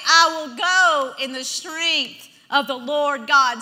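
loud, slow, resolute proclamation; bold declaration. Shown as a demonstration. Preacher showing what to say instead of complaining, loud and bold. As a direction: declare it with long, weighted words. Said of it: "You say".

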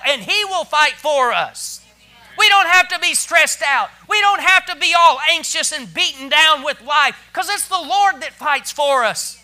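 rally the church with conviction; full-force preaching. Real, not acted.

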